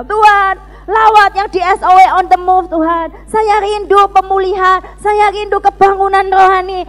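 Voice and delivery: high, fervent plea; crying out in prayer. Retold or imitated. Student pleading for revival, crying out fervently. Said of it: "saya selalu menjerit".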